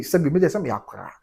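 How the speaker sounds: normal talking voice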